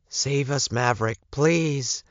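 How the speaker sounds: high voice begging for rescue; high, desperate call for help; urgent plea. A narrator's reading. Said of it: "a soft voice of someone in danger, a soft plea".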